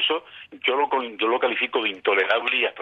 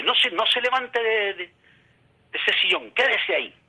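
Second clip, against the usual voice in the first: much higher, urgent voice of a nurse; alarmed hospital staff; 11 semitones higher, slower than usual. Retold or imitated. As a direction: play the nurse, high-pitched and urgent, slowed on the warning. Staff voiced ordering him to stay.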